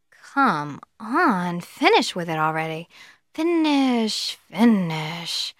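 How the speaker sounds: drawn-out, exasperated urging; impatient prospect. Acted. Fast-talking prospect thinking about a slow salesman, impatient. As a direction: act a customer silently begging someone to hurry up. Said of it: "he's going to think".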